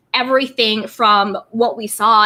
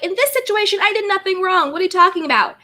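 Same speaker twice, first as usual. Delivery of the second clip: mock defensive; 7 semitones higher, faster than usual. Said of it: "I could be like".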